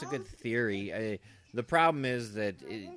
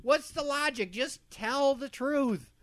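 mock exasperation; 13 semitones higher, slower than usual. Mock frustrated viewer at plot.

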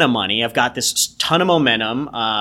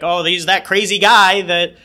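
mocking gossipers; 6 semitones higher, 4 dB louder than usual. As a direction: sneer it like gossiping neighbours. Imagined judging people.

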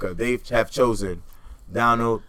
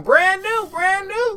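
mocking squeal; 4 dB louder, much slower than usual. Mocking voters, high squeal.

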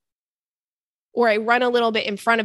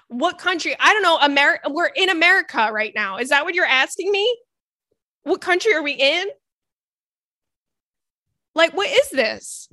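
exasperated rant; 5 semitones higher than usual. Real, not acted. To the rude barista, higher indignant outburst. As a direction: fire back at the snobby barista.

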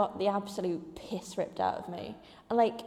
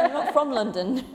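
loud, higher laughing protest; mock outrage; 4 semitones higher, 7 dB louder than usual. Student denying she is from London, mock-indignant. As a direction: insist loudly, hammed-up and amused.

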